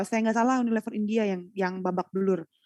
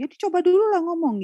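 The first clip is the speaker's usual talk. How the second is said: much higher, louder snappish retort; annoyed interviewer; 9 semitones higher, 4 dB louder than usual. Retold or imitated. Interviewer irritated at a candidate who refuses to try, loud snap. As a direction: play the irritated interviewer pushing back. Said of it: "yang ada sewot".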